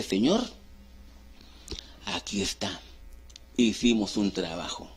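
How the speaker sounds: questioning call, then hushed and low; humble address to God. Acted. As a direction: report softly to the Lord, pausing after the call.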